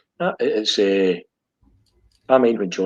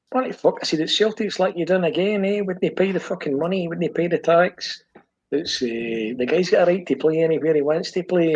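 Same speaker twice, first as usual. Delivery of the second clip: higher-pitched, indignant pushback; exasperated retort; 6 semitones higher than usual. Retold or imitated. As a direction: argue back like it's obvious. Retells arguing with his brother.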